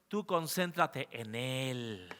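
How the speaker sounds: hushed, gentle counsel; soft urging. Real, not acted. Quiet closing exhortation.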